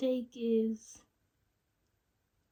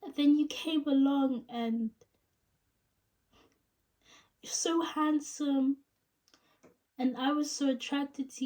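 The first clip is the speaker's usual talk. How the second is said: halting, higher tearful break-up confession; Rachel; 4 semitones higher than usual. Acted. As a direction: ache through long pauses, voice pitched up near tears. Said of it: "sad tone".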